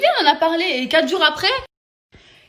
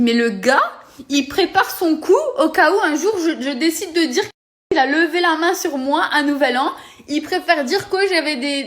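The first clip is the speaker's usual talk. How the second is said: loud, higher furious rant; angry rant; 4 semitones higher, 5 dB louder than usual. Real, not acted. Furious young woman denouncing her ex, loud and outraged. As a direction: build into an outraged tirade. Rising fury at ex.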